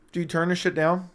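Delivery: normal talking voice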